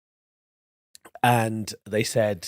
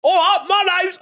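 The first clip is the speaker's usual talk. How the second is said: much higher, louder laughing East End audition; overdone Cockney audition line; hammed-up East End accent; 6 dB louder than usual. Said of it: "your East End accent".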